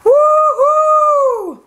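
high, loud sledding whoop; gleeful squeal. Acted. Kids sledding down the hill, squealing with joy.

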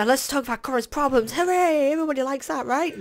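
high, over-bright sarcastic cheer; mock cheerfulness. Player announcing his problems to viewers, mock cheerfulness.

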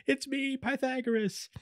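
very high little-robot voice; high squeaky Pythagoras. Retold or imitated. Pythagoras's little head reassuring the crew, high squeaky voice. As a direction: pipe up high so they don't shoot. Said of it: "head pulls up and is like".

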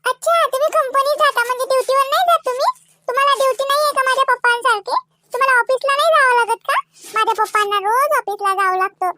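put-on high child voice with questioning lilt; inquisitive little niece. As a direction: quiz uncle like a curious child.

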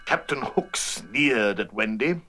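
ordinary delivery

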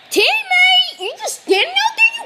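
high, loud call across the ring. Real, not acted. Player shouting at a teammate standing idle, high loud call. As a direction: yell to get the teammate moving.

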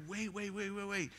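mock attempt to cut in on chattering kids; flustered interrupting.

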